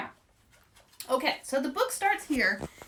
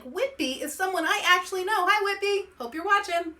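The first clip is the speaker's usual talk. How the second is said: ordinary delivery, nothing marked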